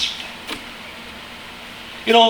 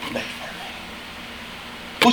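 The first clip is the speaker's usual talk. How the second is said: hushed reverence; 9 semitones lower, 11 dB quieter, slower than usual. Real, not acted. Preacher hushes on the cross.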